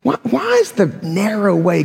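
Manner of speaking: ordinary delivery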